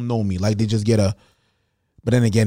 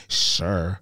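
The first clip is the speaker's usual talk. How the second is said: SURE drawn out slowly, as people misread it; mistaken-tone sure; 8 semitones lower than usual. Retold or imitated. Man mimicking how friends misread his text, SURE drawn out. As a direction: draw out SURE as a loaded reply. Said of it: "but they think I say".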